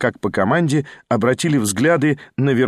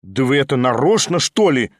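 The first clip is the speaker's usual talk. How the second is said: plain reading voice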